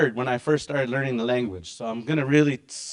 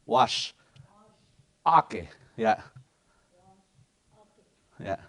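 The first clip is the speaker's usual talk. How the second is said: ordinary delivery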